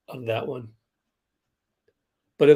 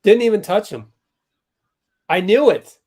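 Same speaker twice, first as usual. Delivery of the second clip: excited; 7 semitones higher, 8 dB louder than usual. Real, not acted. Fan vindicated on replay call.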